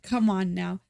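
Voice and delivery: normal talking voice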